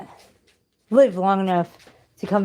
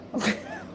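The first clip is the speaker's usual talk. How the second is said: high laugh; 5 dB quieter than usual. Real, not acted. Laughs after reenacted shout.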